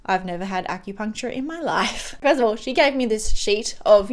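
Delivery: ordinary delivery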